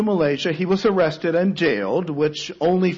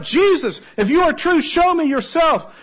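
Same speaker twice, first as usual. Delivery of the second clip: high, loud desperate plea; crying out; 12 semitones higher, 4 dB louder than usual. Retold or imitated. Prisoner cries out to Jesus in his cell, loud and desperate. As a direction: cry out to Jesus for a sign. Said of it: "He cried out".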